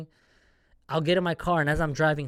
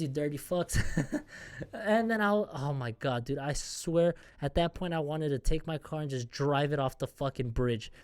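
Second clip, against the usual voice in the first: usual speaking voice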